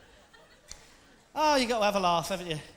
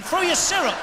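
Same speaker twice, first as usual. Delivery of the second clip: high, loud punch on THROUGH; louder, higher punchline; 8 semitones higher, 8 dB louder than usual. Real, not acted. Punchline repeat.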